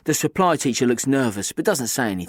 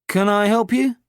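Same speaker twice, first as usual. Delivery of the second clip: supply teacher voice; 9 semitones higher, 5 dB louder than usual. A narrator's reading.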